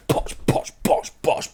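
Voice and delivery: mimicking punches in a breathy whisper; whispered punch noises. Streamer imitating a fighter landing punches, whispered. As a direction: whisper each bosh like a landed blow. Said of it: "as L T G's just going".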